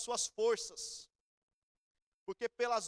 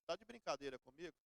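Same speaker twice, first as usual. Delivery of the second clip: inner grumble; 5 semitones lower, 10 dB quieter than usual. Retold or imitated. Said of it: "Pensei, não falei".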